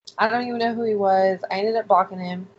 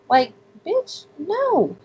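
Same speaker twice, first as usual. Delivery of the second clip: "no" jumps high in mock disgust; scornful no; 9 semitones higher, much slower than usual. Real, not acted. Telling the listener her reaction to the creep, scornful. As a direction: flick him off, pitch soaring on the no.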